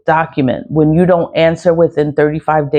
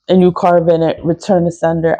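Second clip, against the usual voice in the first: ordinary delivery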